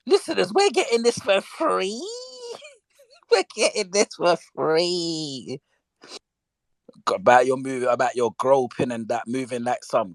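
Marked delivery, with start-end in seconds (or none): 0.1-2.1 gleeful disbelief. Real, not acted. Delighted aside to listeners. 2.7-5.6 slow, stretched words through laughter; gleeful, nearly crying with laughter; helpless laughter. Real, not acted. Repeats it, overcome with laughter.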